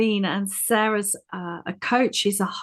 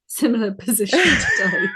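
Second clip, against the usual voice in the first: laughing; 8 semitones higher, 4 dB louder than usual. Real, not acted. Shared joke about being tired.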